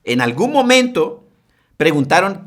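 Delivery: usual speaking voice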